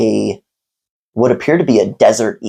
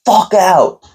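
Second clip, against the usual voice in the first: high, mock furious yell; imitated angry yell; 9 semitones higher than usual. Owner throwing customer out, imitated high angry yell. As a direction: throw him out, pitched way up. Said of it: "It's like".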